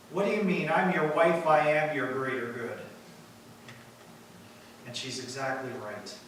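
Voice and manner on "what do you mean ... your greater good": usual speaking voice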